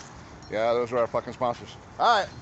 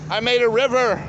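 higher, louder, proud of the mess; excited shout; 8 semitones higher, 5 dB louder than usual. Real, not acted. Man boasting to camera about his river, excited. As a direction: announce it like a triumph.

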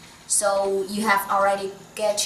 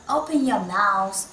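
usual speaking voice

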